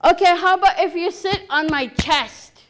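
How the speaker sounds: loud, high, heated offer; getting angry. Acted. Chief bargaining with Frog Woman, angrily. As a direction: make the offer at the end of your patience. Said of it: "Now he's really getting angry".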